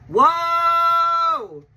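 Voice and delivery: loud, high drawn-out whoa; hype yell. Shown as a demonstration. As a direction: let out a big hype whoa. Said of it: "Sorry, I had to do it".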